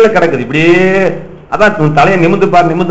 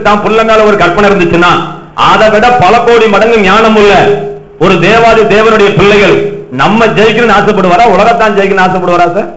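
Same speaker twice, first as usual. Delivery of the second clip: louder, higher preacher's challenge; fiery preaching; 5 semitones higher, 4 dB louder than usual. Acted. Preacher pressing the congregation, fervent full-force preaching. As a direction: preach it hard, pressing every question.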